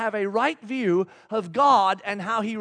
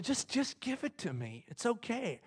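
much softer, soothing; gentle reassurance; 12 dB quieter than usual. Retold or imitated. God coaxing us to hand it over, soft reassurance. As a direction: coax softly, hushed and patient.

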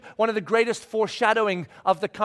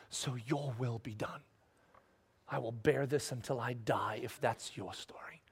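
much softer and lower, nearly a whisper; hushed prayer; 9 semitones lower, 12 dB quieter than usual. Acted. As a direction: pray it close and surrendered. Model prayer of surrender.

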